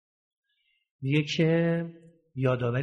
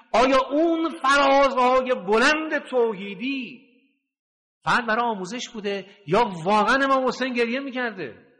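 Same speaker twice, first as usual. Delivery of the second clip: impassioned preaching; 7 semitones higher than usual. Real, not acted. Impassioned rhetorical questions.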